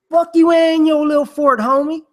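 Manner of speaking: high, loud mocking taunt; rude visitor. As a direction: sneer it at the kid, high and loud.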